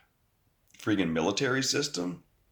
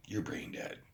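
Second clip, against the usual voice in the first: whispered jab; 12 dB quieter than usual. Real, not acted. Whispered insult to viewers who missed the rhyme. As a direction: hiss the insult quietly like a secret.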